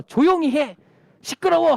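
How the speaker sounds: loud, high scolding from the crowd; hostile silencing. Acted. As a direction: shout him down, fed up. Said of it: "할 때 더 크게".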